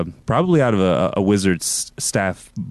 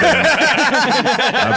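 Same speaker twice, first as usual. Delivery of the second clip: sudden high burst of laughter; loud laugh; 5 dB louder than usual. Real, not acted. Laugh mid speech.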